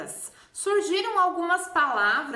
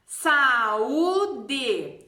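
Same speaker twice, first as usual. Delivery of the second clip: "saúde" silabado, SA-Ú-DE, mais forte; U como sílaba forte; 4 dB louder than usual. Shown as a demonstration. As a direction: separe "saúde" com o Ú isolado e alongado. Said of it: "Esse U é a sílaba forte". (hiato bem marcado)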